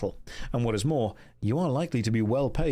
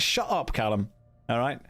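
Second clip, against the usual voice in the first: ordinary talk, nothing marked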